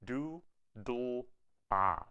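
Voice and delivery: "Do", "dl", "ah" spoken as three separate syllables; triplet syllables. Shown as a demonstration. Teacher to students, spelling out the syllables. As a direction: sound out "Do" "dl" "ah" one at a time. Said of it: "we're gonna say three syllables".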